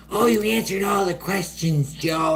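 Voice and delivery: sing-song like praising a third grader; talking down to a child. Retold or imitated. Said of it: "she's talking to him like he's a fucking third grader".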